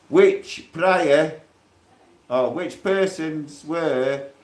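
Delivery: slow, deliberate reading aloud; reading a document aloud, slowly; reading out a charge. Acted. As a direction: spell out each legal phrase slowly. Reads out bail conditions.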